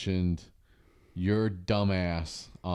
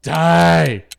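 loud screamed DIE; 9 semitones higher, 15 dB louder than usual. Acted. Shouted sting in podcast intro.

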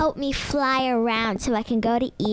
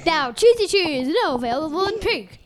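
upbeat commercial read, louder; ad announcer; 4 semitones higher, 4 dB louder than usual. Pretend. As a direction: sell it like a TV ad. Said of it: "a quick break from our sponsor".

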